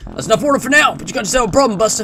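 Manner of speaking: high-pitched American announcer; hammed-up Cold War American voice; American accent. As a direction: jab it like an old American instructor. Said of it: "like, an American voice".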